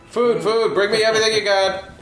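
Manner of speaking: high, fast, laughing demand; greedy demanding voice. Acted. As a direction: bark orders like a hungry king. Voicing the character ordering food.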